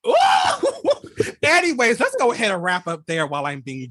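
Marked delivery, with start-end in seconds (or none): 0.2-1.4 very high laughing shriek. Real, not acted. Shrieking laugh while being dragged.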